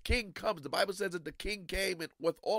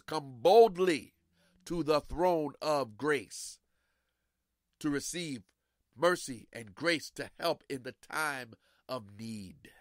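slow solemn quote; 3 semitones lower, slower than usual. Acted. Preacher quoting scripture slowly.

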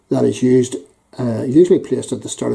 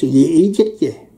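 mock insult; 5 semitones higher than usual. Acting out insult.

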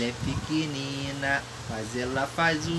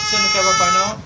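put-on much higher and louder baby talk; 5 semitones higher, 8 dB louder, much faster than usual. Father teasing the baby in a loud high squeal.

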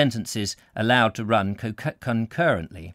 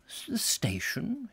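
lightly voiced vague old canon, slow and quiet; a hint of a bewildered clergyman; puzzled inquiry; 10 semitones higher, 8 dB quieter than usual. A narrator's reading. Canon puzzled by the sergeant's question, slow and quiet. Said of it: "he inquired".

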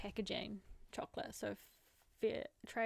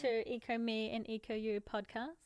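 podcast greeting; 9 dB louder than usual. Acted. Opening the podcast.

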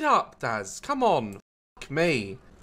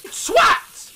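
shout; 11 semitones higher, 3 dB louder than usual. Real, not acted. Shouting at game creature.

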